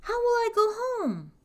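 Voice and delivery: high-pitched, dismayed cry; boy calling out in panic; distressed yell. A narrator's reading. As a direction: wail it across the water. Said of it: "yelled the boy from the middle of the lake".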